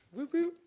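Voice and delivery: short high-pitched siren whoops; two quick, high vocal siren chirps; imitated police siren. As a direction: mimic a squad car's whoop.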